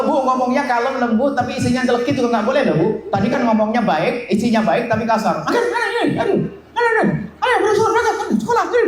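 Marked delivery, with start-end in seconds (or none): 5.4-8.8 high, hurried mock fuss; fussing mother. Mother rushes her child off to school, high fussing voice. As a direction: chase the kid out the door.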